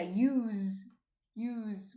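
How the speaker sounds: "use" drawn out as YOOZ; Z sound. Shown as a demonstration. Spelling out the Jersey plural you, "use" as YOOZ. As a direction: end "use" on a voiced, buzzing z. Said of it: "with a Z sound".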